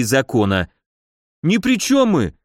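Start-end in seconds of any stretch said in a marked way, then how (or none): 1.4-2.3 sudden cry. A narrator's reading. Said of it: "выкрикнул вдруг один из".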